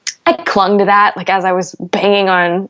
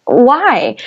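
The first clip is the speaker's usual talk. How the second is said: raised, slower, exasperated plea to God; a grieving mother crying out why to God, raised and exasperated; mock angry prayer; 5 semitones higher, slower than usual. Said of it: "they were like".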